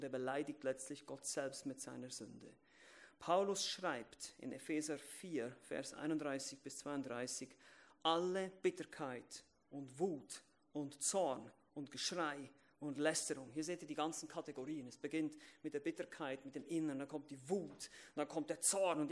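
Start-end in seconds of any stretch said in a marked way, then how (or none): none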